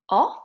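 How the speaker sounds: "o" rising like a question; second tone. Shown as a demonstration. Teacher drills vowel tones, "o" rising. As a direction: lift "o" sharply upward. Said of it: "second tone question".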